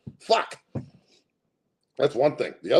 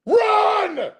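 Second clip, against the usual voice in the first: high, loud panicked shout; mock scream; 7 dB louder than usual. Streamer acting a zombie movie scream, high panicked shout. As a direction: scream it like a horror movie victim.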